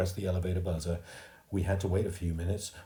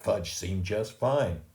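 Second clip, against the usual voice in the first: usual speaking voice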